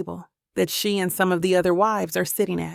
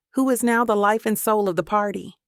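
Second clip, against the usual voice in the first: plain reading voice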